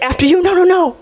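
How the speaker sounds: exaggerated politeness rising to a high squeaky after you; overly polite.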